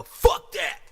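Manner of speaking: sudden high, loud shout. Real, not acted. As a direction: blow it off with a yell. Hyped outburst on podcast.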